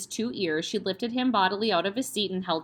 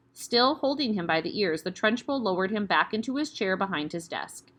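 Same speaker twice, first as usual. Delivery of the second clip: ordinary delivery, nothing marked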